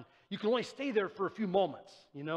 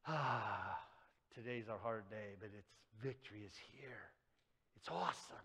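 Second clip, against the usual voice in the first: pained quiet triumph; 10 semitones lower, 13 dB quieter than usual. Acted. Jesus in pain, victorious.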